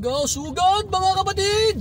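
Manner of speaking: high, loud rallying cry; rallying shout. Real, not acted. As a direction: charge ahead, rally the viewers.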